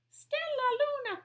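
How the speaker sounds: very high, held sing-song name; teacher's sing-song name. Shown as a demonstration. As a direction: call the name up high for the children. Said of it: "Can you say that?".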